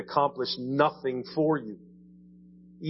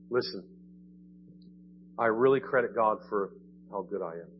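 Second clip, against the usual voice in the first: low, hushed mock humility, dropping softer at the end; mock-humble boast; 5 semitones lower, 3 dB quieter than usual. Church addressing the world about its goodness, mock-humble. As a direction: imitate a falsely modest confession, trailing down.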